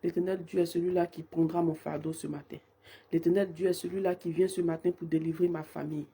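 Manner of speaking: ordinary talk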